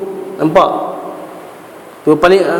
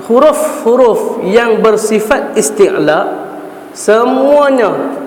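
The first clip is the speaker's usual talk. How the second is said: drawn-out recited quote, rising on semuanya; slow, lifted recitation of the text; quoting the author, slow; 3 semitones higher, much slower than usual. Shown as a demonstration. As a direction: read the rule slowly and deliberately. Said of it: "dia kata".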